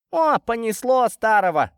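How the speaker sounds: high, loud exclamation as the mother; exasperated mother. Acted. Mother grumbling about the old man, exasperated. Mother scoffs at her husband.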